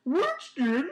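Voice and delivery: high, loud questioning puppy voice; puppy dog voice. Pretend. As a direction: coo the name upward. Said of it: "or is it".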